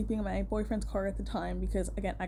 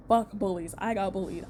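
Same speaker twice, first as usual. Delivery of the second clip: usual speaking voice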